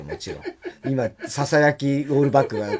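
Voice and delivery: ordinary talk, nothing marked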